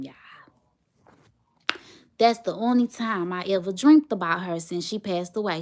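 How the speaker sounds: ordinary talk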